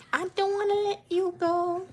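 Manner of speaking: mock Milo. Voicing Milo mockingly.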